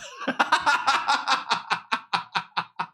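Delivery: shrieking laugh; cracking up in disbelief; high burst of laughter. Real, not acted.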